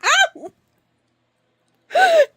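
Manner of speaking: very high, loud yelps; real pain yelps. Real, not acted. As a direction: yelp sharply in pain. Cat clawing her.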